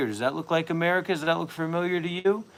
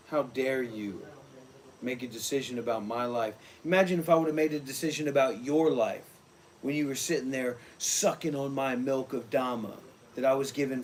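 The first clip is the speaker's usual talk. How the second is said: guru scolding; 2 semitones lower than usual. Acted.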